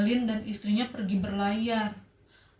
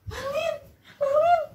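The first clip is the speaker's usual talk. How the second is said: put-on high, loud cry of an old mother; mother calling; 7 dB louder than usual. Mother spotting her son on the beach, crying out high. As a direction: call out to your long-lost son. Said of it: "katanya gitu".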